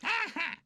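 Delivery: high, loud gloating giggle; smug baby laugh. A narrator's reading. Pamper laughing at the annoyed toys, smug giggle. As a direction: giggle at their complaints. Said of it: "grinned Pamper".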